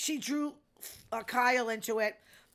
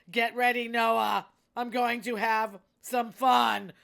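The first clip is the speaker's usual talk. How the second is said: mock gleeful threat; 7 dB louder, slower than usual. Mock threat, retold quote.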